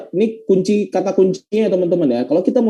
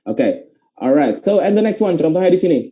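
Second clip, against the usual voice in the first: ordinary talk, nothing marked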